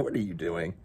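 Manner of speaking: ordinary delivery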